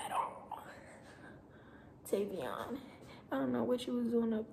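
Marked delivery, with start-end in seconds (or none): 0.1-0.6 a soft whispered echo; half-laughing whisper. Shown as a demonstration. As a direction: breathe it out again as an aside. Repeats it in a whisper.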